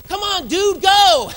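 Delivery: high, loud mock toddler yelling at traffic. Pastor imitating his toddler son yelling at the slow driver. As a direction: squeal it like an impatient little kid. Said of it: "I hear him out of nowhere go".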